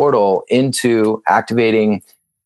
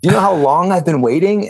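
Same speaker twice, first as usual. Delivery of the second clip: eager and much higher, playfully overjoyed; retold excited greeting; 8 semitones higher than usual. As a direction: act thrilled to finally be called.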